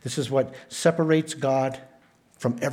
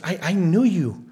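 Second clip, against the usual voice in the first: higher, louder imitated plea; emphatic cry; 6 semitones higher, 5 dB louder than usual. Preacher voices the rejected pleading with Jesus, emphatic cry. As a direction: protest as if crying out to be recognised. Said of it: "it's a cry of emphasis".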